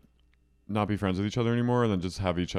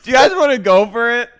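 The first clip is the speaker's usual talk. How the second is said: high, loud, fast pleading; drunk Miranda; 12 semitones higher, 12 dB louder than usual. Retold or imitated. Miranda rallying the girls for wine, loud fast pleading. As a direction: rope everyone into the bottle. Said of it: "She goes".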